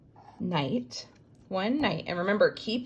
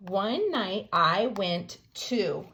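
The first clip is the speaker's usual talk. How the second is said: slow reread; slower than usual. Shown as a demonstration.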